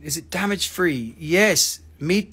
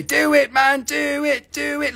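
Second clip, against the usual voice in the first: urging crystal skull voice; 7 semitones higher than usual. Acted. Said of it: "My Crystal Skull say".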